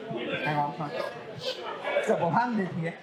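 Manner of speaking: muffled, speaking into the glass; words half into a beer glass; talking into a glass. Real, not acted. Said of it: "Trying to talk into the glass and drink the microphone".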